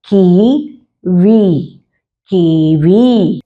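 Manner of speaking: "कीवी" drawn out very slowly, long ई held; slow spelled-out reading. Shown as a demonstration. Teacher reading a word to young pupils, very slowly. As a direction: stretch "कीवी" syllable by syllable, long ई. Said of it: "तो इसे कैसे पढ़ेंगे?".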